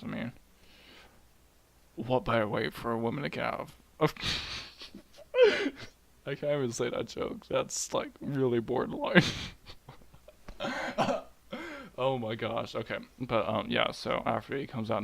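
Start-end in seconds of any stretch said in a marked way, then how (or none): 4.2-5.0 stifled, voiceless laugh; real laugh. Real, not acted. Breaks up at own joke. 10.3-11.2 high burst of laughter; helpless giggle at your own bad joke; real laugh. Real, not acted.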